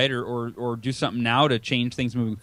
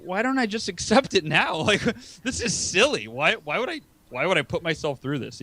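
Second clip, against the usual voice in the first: amused self-talk; 4 semitones higher than usual. Retold or imitated. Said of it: "You're like".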